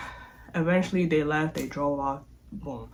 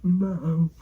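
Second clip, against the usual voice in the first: calling out high, a little louder; calling mom; 4 semitones higher, 3 dB louder, much slower than usual. Retold or imitated. Seeing Mom pull up, calling out to her high. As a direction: call out to Mom as she pulls up.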